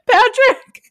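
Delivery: high squeal of the name through laughter; breaking into a loud laughing shriek. Real, not acted. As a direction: shriek the name while cracking up.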